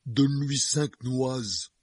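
slow, drowsy giant mumble; sleepy. A narrator's reading. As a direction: say it half-asleep, slowly. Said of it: "d'une voix ensommeillée".

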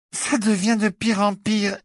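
voix pâteuse, lente, nez bouché. A narrator's reading. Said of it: "d'une voix pâteuse". (voix pâteuse de nez qui saigne)

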